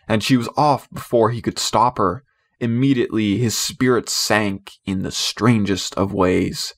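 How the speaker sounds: plain reading voice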